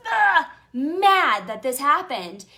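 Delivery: loud, high, slow, worked-up anger; loud, slow, high-pitched anger. Retold or imitated. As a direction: lean into how mad you were. Reliving her anger.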